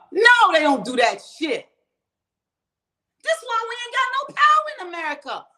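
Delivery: high, exasperated cry; indignant outburst. Real, not acted. Ranting to viewers about having no power in America, indignant outburst. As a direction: burst out in real indignation.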